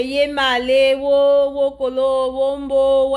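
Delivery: loud African-accented exclamations; African-accented exclamations, punched out; African accent slipping out. Shown as a demonstration. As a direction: let the African accent burst out on the interjections. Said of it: "ça pouvait sortir des termes comme ça".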